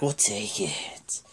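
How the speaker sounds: slow, soft mock-seductive voice; sexual talk. Wrestler volunteering for the open spots, slow mock-sexy murmur. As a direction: imitate a sultry come-on, hushed and drawn out. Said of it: "He's always all so sexual and talks like this".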